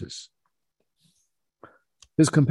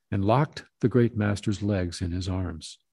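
ordinary delivery, nothing marked

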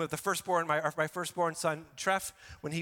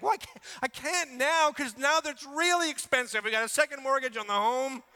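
much higher, louder fretting complaint; whiny excuse; 7 semitones higher, 4 dB louder than usual. Retold or imitated. Pastor voicing a parent of college kids, high and fretful. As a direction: plead poverty to God, all worked up.

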